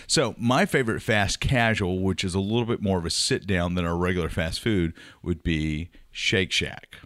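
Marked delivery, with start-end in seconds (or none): none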